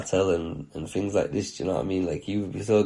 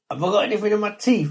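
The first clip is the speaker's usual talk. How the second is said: higher, quicker innocent question; quoting the friend; 13 semitones higher, 6 dB louder, faster than usual. Retold or imitated. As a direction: ask it cheerfully, oblivious to the mess. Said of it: "He's like".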